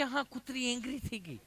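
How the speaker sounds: mock an English-educated visitor in a mild fluster. Visitor reports to Hiteshbhai that the dog got angry. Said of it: "English ભણેલું".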